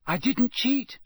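high, sharp and loud protest; a boy stung by the accusation, said sharp and high; sharp denial. A narrator's reading. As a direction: snap back in defence. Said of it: "said Harry sharply".

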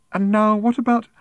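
high, loud, prim coaxing, cut off; prim raffle seller. A narrator's reading. Woman turning to Fenny, prim and coaxing. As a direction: start a prim high pitch.

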